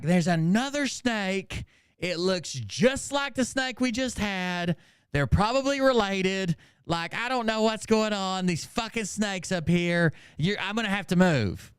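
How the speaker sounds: high, slowed mock-whiny voice; imitating a panicked sister. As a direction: act out a frantic phone call. Voicing panicked sister on phone.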